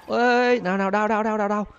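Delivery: high, rapid "đau" cries; pained yelps. Real, not acted. Hit by police bullets, high mock-pained yelps. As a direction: yelp like every shot stings.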